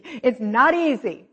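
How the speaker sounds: slow, loud, stressing "not"; a slow, loud "It's not easy"; emphatic not. Shown as a demonstration. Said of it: "It's simple".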